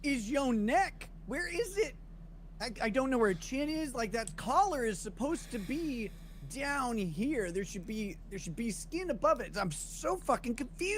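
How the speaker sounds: mock-bewildered, slowly; incredulous. Real, not acted. Marveling at the neck.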